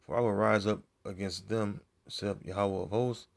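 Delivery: measured, solemn Bible reading; King James passage read with weight; scripture reading. Acted. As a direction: read the prophecy out like a preacher at the pulpit. Reads Isaiah aloud.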